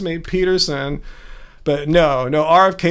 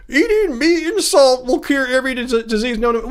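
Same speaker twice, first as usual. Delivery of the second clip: Jordan Peterson; 6 semitones higher than usual. Retold or imitated. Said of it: "Jordan Peterson thinks".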